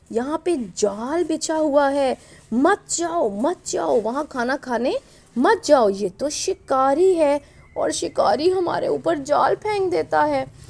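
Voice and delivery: high, stretched-out pleading warning; storyteller's acted birds urging the others back; warning pigeons. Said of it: "वो बता भी रहे हैं ऊपर बैठे हुए कबूतर". (ऊँची आवाज़ में समझाते, रोकते हुए)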